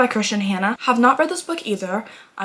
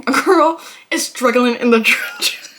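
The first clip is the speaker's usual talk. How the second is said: laughing while talking; 6 dB louder, slower than usual. Real, not acted. Laughing through line.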